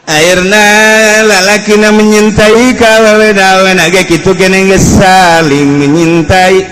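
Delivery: normal talking voice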